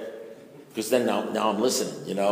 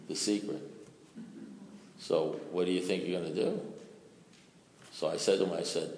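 normal talking voice

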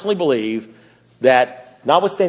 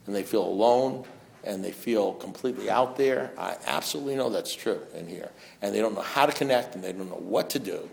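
much softer and lower; hushed; 7 semitones lower, 8 dB quieter than usual. Real, not acted. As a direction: drop the voice right down to a hush. Talking of lonely sober alcoholics.

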